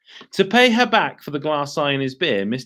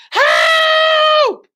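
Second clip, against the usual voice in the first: high, loud shriek; scream; 8 dB louder than usual. Acted. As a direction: shriek for help. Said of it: "screamed Missus Twit".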